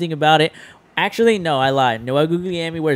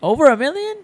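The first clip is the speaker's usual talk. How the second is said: incredulous echo; 11 semitones higher, faster than usual. Real, not acted. Echoing his friend's number in disbelief, high incredulous rise. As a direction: pitch jumps up high on over, rising question.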